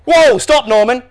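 high, loud fatherly yell; alarmed yell. A narrator's reading. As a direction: yell to halt your son in his tracks. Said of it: "yelled Norm's dad".

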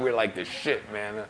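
normal talking voice

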